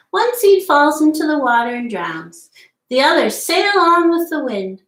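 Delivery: storybook read-aloud voice, lively and clear; reading to children. Acted. Teacher reading The Tiny Seed aloud to children, lively storybook voice. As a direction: read the tale to little listeners. Reads picture book aloud.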